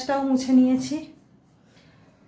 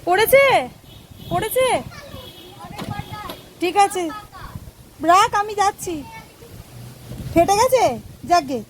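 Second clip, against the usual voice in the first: higher, loud calls across the yard; calling out; 8 semitones higher, 4 dB louder than usual. Real, not acted. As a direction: shout over to the family outside. Calling out to family outside. (দূরে বাড়ির লোককে উঁচু গলায় ডাকা)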